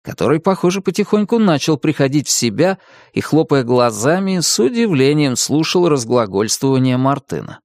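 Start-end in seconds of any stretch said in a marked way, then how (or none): none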